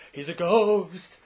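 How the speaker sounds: loud, slow, drawn-out wail on "ghost"; mock spooky ghost. Host joking that the dead boy returns, spooky ghost wail. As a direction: stretch "ghost" into a playful haunting wail.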